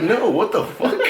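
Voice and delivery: high, loud refusal breaking into laughter; shocked protest. Retold or imitated. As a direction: act out an appalled no, laughing. Retells his shocked protest to girl.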